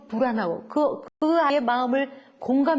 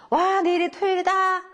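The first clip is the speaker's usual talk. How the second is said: put-on excited child; 7 semitones higher than usual. Shown as a demonstration. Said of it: "여러분이 한번 읽어보세요".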